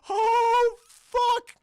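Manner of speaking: high, loud, drawn-out dread; horrified. Acted. Charlie realising it's the Joker, horrified. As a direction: let the horror stretch the first word.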